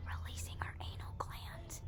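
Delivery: hushed, whispered aside; whispering. Real, not acted. Telling what the scared pet really does, whispering. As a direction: drop to a whisper.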